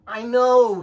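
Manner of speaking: high, loud, delighted squeal of a reply; cheerful little lamb. Acted. Wilbur the lamb answering his parents, high and gleeful. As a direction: chirp it like a proud young sheep.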